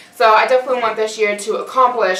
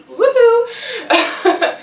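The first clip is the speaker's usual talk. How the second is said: high happy woohoo with a laugh; excited cheer; 14 semitones higher than usual. Real, not acted. Cheering over first YouTube money.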